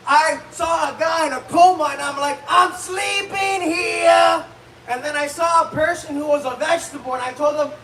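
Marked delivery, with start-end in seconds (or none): 2.5-4.4 high, very drawn-out indignant yell; brash mock shout. Comic to a paraplegic, brash indignant shout. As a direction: holler it, stretching every word. Same catchphrase at coma patient.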